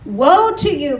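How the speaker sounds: WOE to you called out loud and high, falling; proclaimed woe. Acted. Preacher thundering the woe at the towns, loud. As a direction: declare Woe like a prophet.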